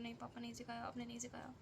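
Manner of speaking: a hint of a child sobbing out no; retold crying. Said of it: "मैं रोते रोते बोल रही हूँ".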